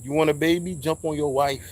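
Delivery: ordinary talk, nothing marked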